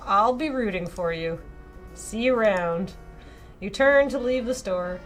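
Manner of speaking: usual speaking voice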